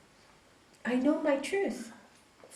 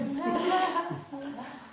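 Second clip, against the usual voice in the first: bright real laugh; a burst of real laughter; laughing at her own retort; 5 semitones higher, 4 dB quieter than usual. Real, not acted.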